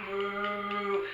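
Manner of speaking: low, soft mooing cow. Pretend. Teacher playing a cow for the kids, low soft moo.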